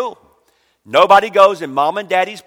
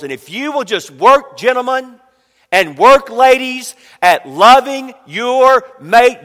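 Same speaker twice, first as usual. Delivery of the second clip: preaching emphasis; 7 semitones higher, 6 dB louder than usual. Acted. Preacher exhorting congregation.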